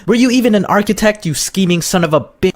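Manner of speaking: hammed-up angry outburst; mock outrage. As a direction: call him out, cut off before the swear.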